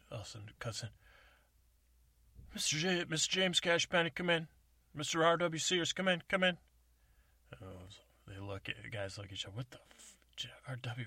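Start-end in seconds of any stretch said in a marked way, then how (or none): 2.5-6.6 over CB radio. Acted. CB radio call cutting in. 9.5-11.1 whispering. Acted. Stunned guys whispering.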